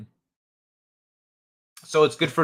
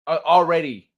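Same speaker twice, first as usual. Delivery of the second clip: ordinary talk, nothing marked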